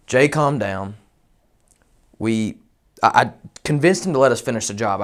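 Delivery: normal talking voice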